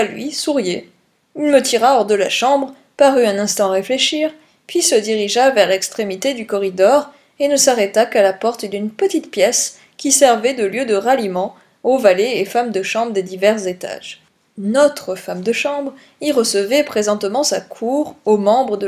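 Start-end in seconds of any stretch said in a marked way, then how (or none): none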